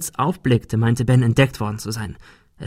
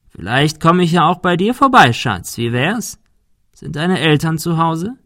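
higher, louder leering taunt; taunting thug; 6 semitones higher, 3 dB louder than usual. A narrator's reading. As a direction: menace her playfully. (höhnisch drohender Schläger)

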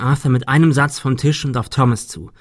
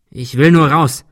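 louder, agitated insistence; desperate demand; 3 semitones higher, 6 dB louder than usual. A narrator's reading. Arthur wants out of the castle, loudly desperate. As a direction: burst out with it, desperate to leave. Cornered gunman demands to leave.

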